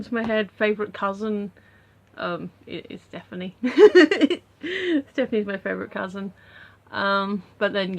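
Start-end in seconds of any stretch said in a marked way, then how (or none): 3.6-4.4 high burst of laughter; loud laugh. Real, not acted. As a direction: crack up after a confession. Laughs after naming favourite cousin.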